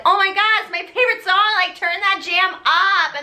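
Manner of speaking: put-on high, excited valley girl gush; gushing teen fan. Girls in car gush over the radio song, high excited. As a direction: gush like a fan hearing her jam.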